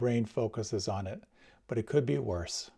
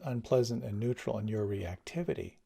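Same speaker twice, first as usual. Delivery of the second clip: ordinary talk, nothing marked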